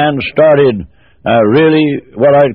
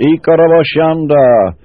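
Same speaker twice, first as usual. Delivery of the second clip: slow, drawn-out chanted tongues, rising on Irowala; speaking in tongues; slower than usual. Retold or imitated. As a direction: intone each word slowly like a heavenly language. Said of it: "interpreted into English".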